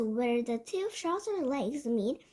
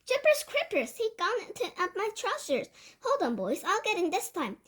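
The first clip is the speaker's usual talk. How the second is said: high-pitched startled hollering; shouted; 6 semitones higher than usual. A narrator's reading. Cook with a mouse up his trousers, shouted. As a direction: holler in a much higher voice. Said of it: "the cook shouted".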